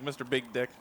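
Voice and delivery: usual speaking voice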